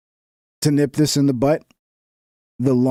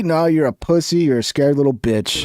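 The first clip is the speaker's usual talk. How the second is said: ordinary talk, nothing marked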